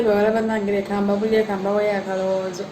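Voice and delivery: normal talking voice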